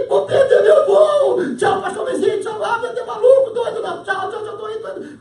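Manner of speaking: very high, loud flustered farewells; hammed-up panic about being late; comic panic. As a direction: blurt hurried goodbyes as you dash off. Acted panic, rushing goodbyes at airport.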